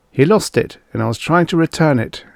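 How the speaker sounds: anxious explaining. Acted. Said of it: "Engel explained".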